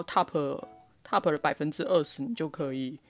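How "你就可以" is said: ordinary delivery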